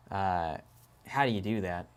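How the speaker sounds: ordinary talk, nothing marked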